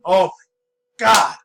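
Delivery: loud, high burst of excitement; excited. Real, not acted. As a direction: blurt it out, thrilled. Gushing about Anna's bazooka gun.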